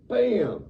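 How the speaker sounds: sudden high, loud "bam!"; punchy sound effect. Retold or imitated. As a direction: slam it out like an impact. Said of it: "He's like".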